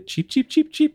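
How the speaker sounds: very high squeaky cheeps; squirrel chirps. Acted. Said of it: "I need to respond in kind".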